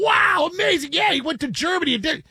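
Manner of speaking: high gushing mock praise; mock amazement. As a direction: gush like an impressed fan. Mock fan reaction.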